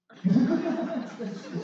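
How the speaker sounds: high bursting laugh at himself; burst of laughter. Real, not acted. Telling friends a dark story, laughing out loud.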